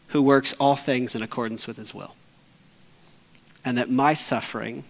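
ordinary delivery

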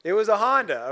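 preacher to congregation, loud comic punchline. Real, not acted. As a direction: shout the reveal for the crowd. Punchline after Ferrari joke.

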